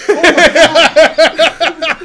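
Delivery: long, loud laughing oh my God. Real, not acted. Friend laughing in disbelief at the hoax. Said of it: "laughing at the story".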